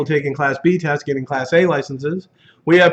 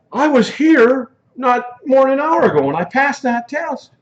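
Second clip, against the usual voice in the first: exasperated customer; 10 semitones higher than usual. Retold or imitated. Instructor voices a frustrated customer.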